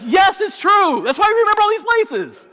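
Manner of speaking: high, loud mock shout; fed-up wife insisting, high and loud. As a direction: yell it at the clueless husband. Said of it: "She's like".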